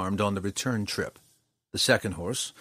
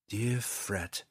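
soft, much quieter murmur; under her breath; 7 dB quieter than usual. A narrator's reading. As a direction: say it almost to yourself. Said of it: "the ranger whispered under her breath".

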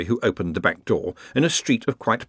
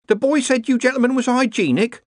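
high-pitched servant woman; worried cook; 4 dB louder than usual. A narrator's reading. The cook answers the visiting wizards, worried. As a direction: pipe up high as a deferential cook.

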